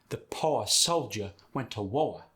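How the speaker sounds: Southern accent, no R at word ends. Shown as a demonstration. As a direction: say "poor" as poh-uh and "war" as woh-uh. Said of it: "would be".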